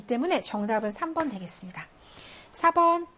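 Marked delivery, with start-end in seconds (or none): none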